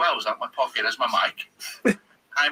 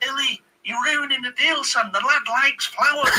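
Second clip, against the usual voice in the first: high, insistent mock scolding; Clough impression; 10 semitones higher, 3 dB louder than usual. Manager telling off his host over the flowers, mock indignant. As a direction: imitate an exasperated football manager, pitched up.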